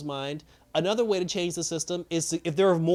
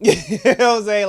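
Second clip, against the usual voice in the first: high, loud burst of real laughter through the words; laughing; 7 semitones higher, 12 dB louder than usual. Real, not acted. After the all-in-jail punchline, laughing hard. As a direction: crack up while checking they get it.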